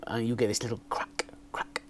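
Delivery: drops to a near whisper about the crackle; whispered aside. Real, not acted. Vlogger confiding about the mic crackle, whispered. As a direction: let it fall to a hushed, breathy whisper. Describing the annoying little crack.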